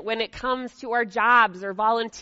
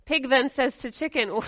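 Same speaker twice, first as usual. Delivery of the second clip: ordinary talk, nothing marked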